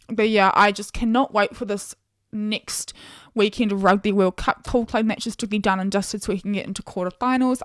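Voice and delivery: normal talking voice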